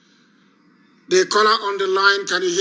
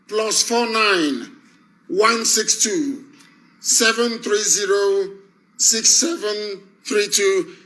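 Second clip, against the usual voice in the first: slow dictation; 3 semitones higher, slower than usual. Real, not acted. Repeating the phone number slowly.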